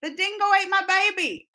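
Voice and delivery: high, hammed-up Australian accent. Shown as a demonstration. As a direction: put on a bad Australian accent. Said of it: "my Australian accent, I say".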